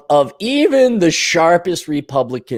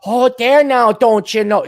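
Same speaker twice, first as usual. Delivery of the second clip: higher, singsong Minnesota voice; mock Minnesotan accent; 5 semitones higher, 3 dB louder than usual. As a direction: tease with an exaggerated Minnesota accent.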